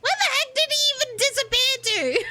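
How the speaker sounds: high, fast squeal of disbelief; real high, hurried exclamation; baffled outcry. Real, not acted. Lost the robot.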